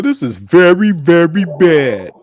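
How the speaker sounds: mock solemn slow very bad; imitating D C. Mocking D C's verdict to Lex, slow and drawn out. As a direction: ham up the dragged out very bad. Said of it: "he said it just like that".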